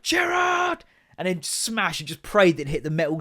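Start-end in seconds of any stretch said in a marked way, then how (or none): 0.0-0.8 high, drawn-out yell of the name; footballer's shout. Acted. Kid walloping the ball at a wall, high playful shout. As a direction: holler it as you strike the ball.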